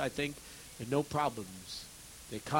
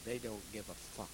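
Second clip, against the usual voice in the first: bitter hush; 5 semitones lower, 13 dB quieter than usual. Retold or imitated. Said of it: "I said".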